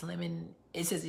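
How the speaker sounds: ordinary talk, nothing marked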